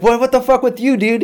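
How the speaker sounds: high, loud acted comeback; indignant high-pitched shouting; angry retort. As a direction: yell back in a retold quarrel. Acted phone quarrel with dad.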